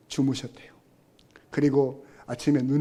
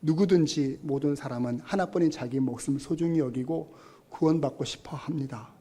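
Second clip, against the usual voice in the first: ordinary talk, nothing marked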